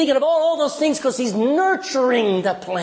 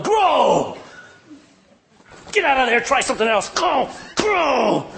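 higher, impatient shouting at the seed; mock yell at crops; 5 semitones higher than usual. Farmer yelling at his crops to grow, hammed-up impatient shout. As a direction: bark orders at the crops like a frustrated farmer.

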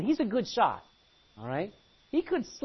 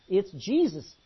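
ordinary talk

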